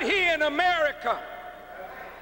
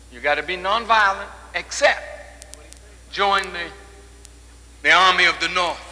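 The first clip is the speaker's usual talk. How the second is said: Lincoln's words, lower and much louder, rattled off fast; mocking Lincoln; 9 semitones lower, 4 dB louder, faster than usual. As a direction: voice Lincoln as a pushy recruiter. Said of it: "and then said".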